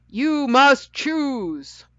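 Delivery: high, loud, very slow command; booming. A narrator's reading. Judge orders the children to decide, booming. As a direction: hand down the order with weighty slowness. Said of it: "boomed the judge".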